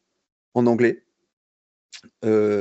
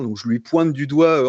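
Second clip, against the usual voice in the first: ordinary delivery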